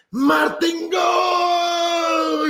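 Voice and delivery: name held very long and high, shouted; stadium goal call. Retold or imitated. Church crowd calling out Martín like a goal. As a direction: stretch it like a soccer goal call. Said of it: "te gritan".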